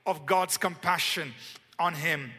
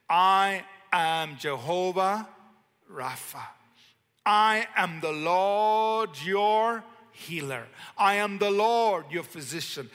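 solemn proclamation; 5 dB louder, slower than usual. Acted. Preacher voicing God's covenant.

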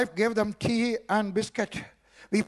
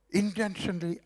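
usual speaking voice

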